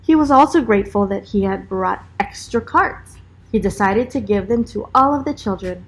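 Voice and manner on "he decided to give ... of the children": ordinary delivery, nothing marked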